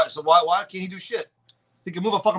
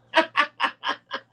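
laugh; 8 semitones higher than usual. Real, not acted. Laughs at clone troopers.

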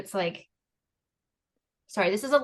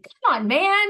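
imitating son; 10 semitones higher, 8 dB louder, slower than usual. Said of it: "started saying".

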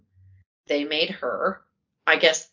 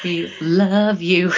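slow, drawn-out adoring fan voice; gushing fan; 5 dB louder, much slower than usual. Acted. Fan gushing a shoutout to Timothy, slow and adoring. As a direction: lay the love on thick, slowly.

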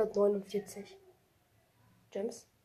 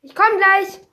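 loud shout to someone out of the room; calling to another room; 10 semitones higher, 18 dB louder than usual. Real, not acted. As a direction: call back loudly through the house.

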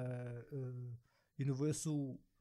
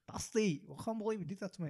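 higher, fast mock girl rant; angry girl; 6 semitones higher than usual. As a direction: rattle it off like an upset girlfriend. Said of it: "have you seen a girl angry".

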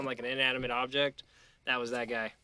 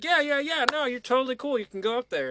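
eager reassurance; 12 semitones higher, 5 dB louder than usual. Retold or imitated. Bouncer eagerly reassuring in story.

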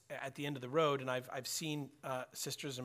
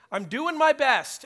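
"I'm doing my best" cried out high and loud, stress on "my"; desperate pleading; 11 semitones higher, 12 dB louder than usual. Retold or imitated. Man on his knees insists to the Lord, loudly. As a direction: beg God to see how hard you try. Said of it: "pleading to the Lord".

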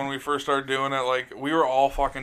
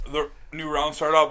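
ordinary delivery

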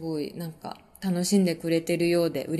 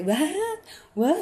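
mock squeal; 7 semitones higher, much slower than usual. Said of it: "みたいなのやった".

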